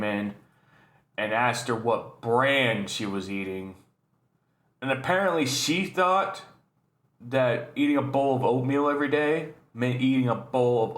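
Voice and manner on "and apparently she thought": ordinary delivery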